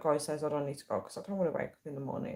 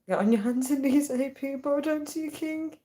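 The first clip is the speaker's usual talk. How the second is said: high-pitched gleeful command, rising; mock royal command; 9 semitones higher, 5 dB louder than usual. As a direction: read it out as a playful, climbing order. Reads out viewer's mock royal command.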